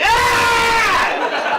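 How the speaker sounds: put-on the class shrieking at the boy's pants; mock class scream. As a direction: shriek like a whole class of kids. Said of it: "everyone was going ... screaming".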